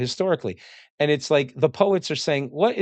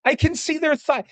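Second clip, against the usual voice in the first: much higher, squealing shock on thigh; scandalized onlooker, peak. Retold or imitated. Greek onlooker at Spartan women, squeaky outrage. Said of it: "looking at the Spartans like".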